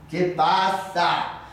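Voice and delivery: slow question at full voice; manager's voice, firmer. Acted. Manager demanding to know the matter, slow firm question. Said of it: "dijo el gerente".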